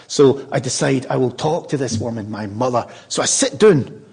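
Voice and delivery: ordinary delivery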